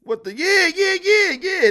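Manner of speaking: high, loud burst of yeahs; excited agreement. Real, not acted. Host finally hears a viewer agree with him, excited. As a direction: jump in loud and high, fired up.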